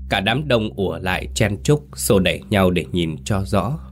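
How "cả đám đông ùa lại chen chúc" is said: plain reading voice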